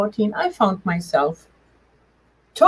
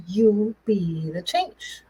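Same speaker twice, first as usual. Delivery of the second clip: slow, softer, deliberate quoted voice; inner voice speaking; 4 dB quieter, much slower than usual. Retold or imitated. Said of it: "this voice said".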